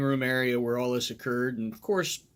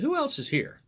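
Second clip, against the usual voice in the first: surprised question; 12 semitones higher than usual. Retold or imitated. Cop asking sharply who else is there.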